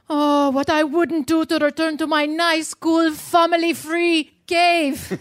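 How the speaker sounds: pine for the cave; mock yearning. Longing for the cave.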